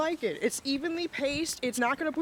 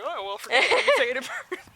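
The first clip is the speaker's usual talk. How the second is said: excited retelling; 6 dB louder, faster than usual. Retold or imitated. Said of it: "so I'm just like".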